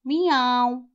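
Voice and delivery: high, light meowing; playful mewing for children; cat meows. Acted. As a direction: meow like a kitten. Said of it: "the cat going meow".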